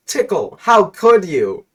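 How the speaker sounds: high, loud, stunned cry; shocked, betrayed outburst; betrayed exclamation. Acted. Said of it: "he exclaims".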